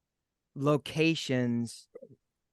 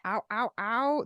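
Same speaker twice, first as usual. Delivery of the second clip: short higher wolf howl; werewolf howl; 8 semitones higher than usual. Pretend. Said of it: "That's my wolf".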